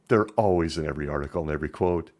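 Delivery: usual speaking voice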